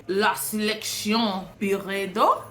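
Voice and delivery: slow, careful French-accented product name; a hesitant mock French pronunciation; attempted French accent. Shown as a demonstration. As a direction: try to say it like a French speaker. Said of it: "How do you say that with a French accent?".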